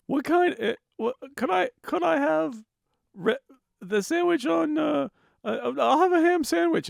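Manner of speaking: very high, hesitant customer voice; imitated customer. Customer ordering a sandwich, high and dithering. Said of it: "people would come in and they'd order a sandwich".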